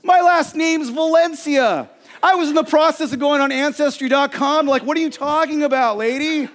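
high, loud, incredulous outburst; hammed-up indignant yelling; boiling-over frustration. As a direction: act out blowing up in disbelief. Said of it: "my blood just boiled over. I am so frustrated".

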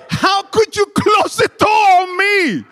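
very high, loud shriek; hammed-up screamed reproach; imitating outraged mom. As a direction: scream it shrilly like a betrayed mother. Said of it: "That's what my mom said".

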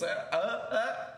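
very high, slow halting uh uh uh from a hulking brute; dim creature stammer. Acted. Hulking creature stalling at the tiny angry man, high slow stammer. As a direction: stammer like a dumb giant caught off guard.

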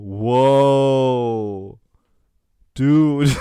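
slow, awed stoner drawl; a dazed, amazed stoner; stoned dude. Retold or imitated. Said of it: "der andere so".

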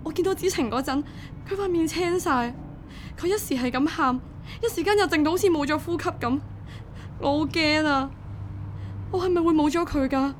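frightened parent recounting an emergency; distraught worry. Acted. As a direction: pour out the whole ordeal in rising panic. Panicked mother recounting child's hospital admission.

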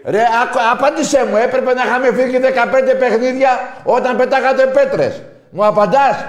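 high, loud furious demand; shouting. Real, not acted. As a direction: yell for an answer. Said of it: "που φωνάζω".

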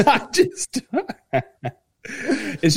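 giggling through the words; can't stop laughing. Real, not acted. Cracking up at the sound clip.

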